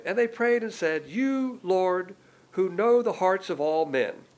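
high, loud, slow prayer reading; declaimed prayer. Acted. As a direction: proclaim it grandly, stretching the address to God. Declaims Peter's great prayer from scripture.

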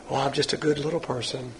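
soft, mock-pious voice; mock solemn, holy. Pastor mimicking self-righteous churchgoers, mock solemn and holy. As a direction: imitate false holiness, dropping the volume. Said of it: "walk around very solemn and holy".

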